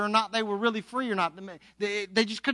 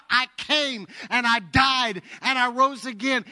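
high, impassioned preaching cry; 5 semitones higher, 4 dB louder than usual. Retold or imitated. Jesus to those buried by life, crying out. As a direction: call it out with raised fervour. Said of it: "Jesus is crying out".

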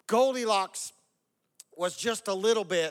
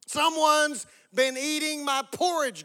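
slow, high-pitched mock growl; big slow bear complaint, hammed up; growling Papa Bear; 8 semitones higher, 5 dB louder than usual. A narrator's reading. Papa Bear finds his porridge eaten, mock growling. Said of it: "growled Papa Bear".

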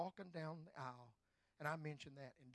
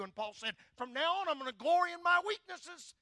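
high, loud proclaiming; full-force preaching; 8 semitones higher, 14 dB louder than usual. Acted. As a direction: thunder it to the crowd.

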